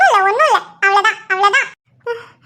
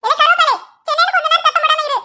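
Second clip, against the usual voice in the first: fast, high-pitched telling-off; scolding; 5 semitones higher, faster than usual. Acted. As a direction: snap at him to hush. Grandmother scolds husband to be quiet.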